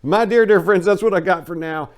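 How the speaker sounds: loud, high, theatrical farewell; hearty sign-off. Real, not acted. Host bidding listeners farewell, loud and theatrical. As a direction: ham up the goodbye to friends.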